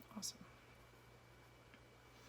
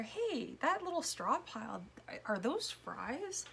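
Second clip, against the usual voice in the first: surprised discovery; 6 semitones higher, 3 dB louder, slower than usual. Pretend. Imagined viewer spotting hidden fries.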